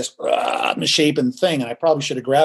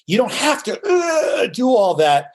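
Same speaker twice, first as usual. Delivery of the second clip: mock overacting; 7 semitones higher than usual. Mocking overdone emotion.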